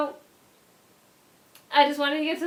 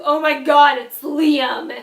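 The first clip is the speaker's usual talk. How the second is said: much louder, gushing oh my God; growing excited shout; 10 dB louder, slower than usual. Retold or imitated. Recognising the friend at the door, now shouting with joy. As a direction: let the excitement burst out. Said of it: "And then it turned into".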